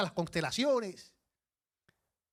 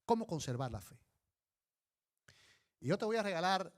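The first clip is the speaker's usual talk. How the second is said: ordinary talk, nothing marked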